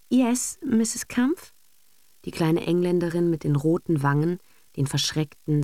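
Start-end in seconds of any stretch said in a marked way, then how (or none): 0.1-1.5 timid English governess. A narrator's reading.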